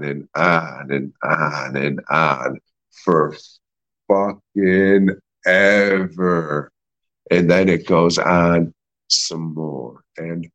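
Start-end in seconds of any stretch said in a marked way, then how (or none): 4.1-6.7 drawn out. Real, not acted. Dragging film complaint.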